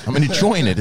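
usual speaking voice

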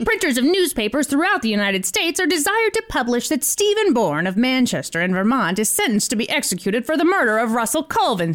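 put-on old-timey newsreel voice, mid-Atlantic. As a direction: read it like a 1930s newsreel. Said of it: "should I do it in an old-timey voice".

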